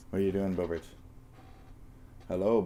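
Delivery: normal talking voice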